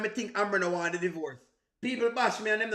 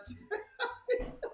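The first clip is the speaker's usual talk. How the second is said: high airy giggle; 14 semitones higher, 8 dB quieter than usual. Real, not acted. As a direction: break into a squeaky giggle. Laughing while asking chat.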